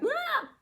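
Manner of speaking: short, very high mock shriek; imitated emotional squeal. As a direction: let out a quick mock squeal. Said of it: "Women are like".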